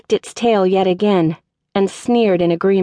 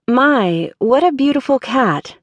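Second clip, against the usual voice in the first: higher-pitched, put-on admiration; insincere flattery; 5 semitones higher than usual. A narrator's reading. Applicant lies to steer the conversation away, insincere flattery. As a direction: gush falsely, lifting the pitch. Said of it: "I lied".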